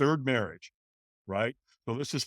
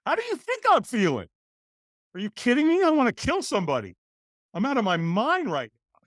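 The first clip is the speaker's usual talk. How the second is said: angry retort; 10 semitones higher, 4 dB louder than usual. Retold or imitated. Retorting to cheerful morning caller.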